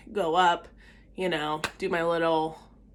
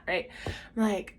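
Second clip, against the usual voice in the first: ordinary talk, nothing marked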